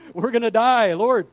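loud, high hammed-up panic; mock panicked prayer. Preacher retelling his storm prayer, mock panicked. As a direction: cry out to heaven in comic alarm.

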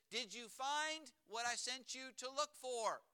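imitated mom checking up on her kid; mom questioning. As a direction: ask deliberately, like mom calling upstairs. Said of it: "she says".

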